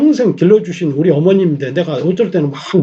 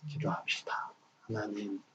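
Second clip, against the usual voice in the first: voiceless hushed call to prayer; whisper; 5 semitones lower, 22 dB quieter than usual. Real, not acted. Pastor opens the closing prayer in a whisper. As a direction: breathe the words into the silence. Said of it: "opening prayer whispered".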